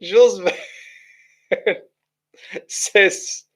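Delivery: slow words broken up by real laughter; can't get the name out for laughing; giggling through a chat reader's name; laughing while reading. Real, not acted. Chuckling over a baffling chat message.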